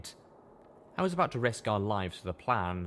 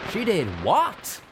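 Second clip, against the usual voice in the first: high, loud, slow gasped question; Calamity can't believe it; gasped disbelief; 11 semitones higher, 8 dB louder, slower than usual. Acted. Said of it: "Calamity gasped".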